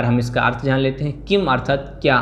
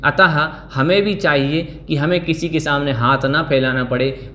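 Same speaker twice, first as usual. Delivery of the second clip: usual speaking voice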